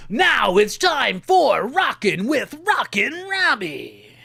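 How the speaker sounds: big, slow, swooping announcer voice; radio show intro. Retold or imitated. Host recreating his school radio show intro, announcing. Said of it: "the show would kick in and it'd go".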